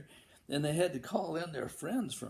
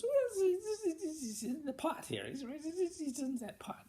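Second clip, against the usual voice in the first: tiny mosquito voice, much higher; squeaky little mosquito. Acted. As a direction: squeak it like a tattling little bug. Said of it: "And he goes".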